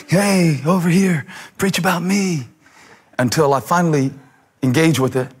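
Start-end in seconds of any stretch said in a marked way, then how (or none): 0.1-2.5 mock yelling. Scripture phrase begging the preacher for attention, mock yelling. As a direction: call out like a pushy attention-seeker, lightly hammed up. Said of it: "scream and yell".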